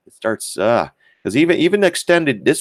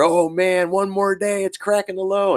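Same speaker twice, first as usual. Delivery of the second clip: high whiny voice of worried put buyers; mock panicky traders; 10 semitones higher than usual. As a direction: whine it like a nervous trader. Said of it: "Everyone's loading up".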